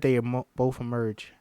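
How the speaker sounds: ordinary delivery, nothing marked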